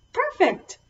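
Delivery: high and pleased; bright. Real, not acted. Pleased after the song.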